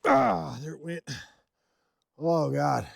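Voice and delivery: pained, wincing voice. Real, not acted. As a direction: wince through the words. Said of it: "my knee went out".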